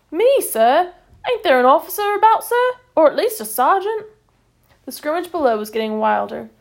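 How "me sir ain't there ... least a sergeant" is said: much higher, louder startled protest; alarmed corporal. A narrator's reading. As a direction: squeak out a panicked objection. Corporal alarmed at taking charge.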